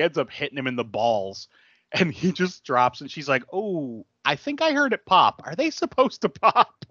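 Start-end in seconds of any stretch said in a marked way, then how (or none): 3.5-6.8 mock innocent girl. Retelling a film scene to his co-host, mock-innocent high voice. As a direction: play the heroine as sweetly clueless.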